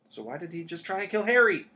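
high, loud, indignant challenge; crying out. A narrator's reading. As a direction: cry it out, rising in protest. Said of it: "cried Hermione".

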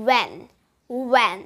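"when" started with a rounded oo-like w, not hoy; when with oo glide. Shown as a demonstration. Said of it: "উ এর মতো একটা sound দিয়ে".

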